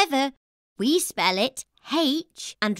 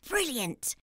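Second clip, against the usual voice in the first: normal talking voice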